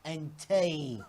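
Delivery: slow, drawn-out mock British accent. Shown as a demonstration. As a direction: politely stretch it out in British. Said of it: "do your best British accent".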